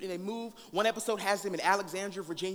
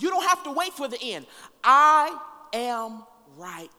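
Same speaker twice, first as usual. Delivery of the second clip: preaching at full force; 4 semitones higher, 8 dB louder than usual. Acted. Preacher reading Martha's plea to the congregation, full force. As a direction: proclaim each line to the room. Preacher reads the Message version.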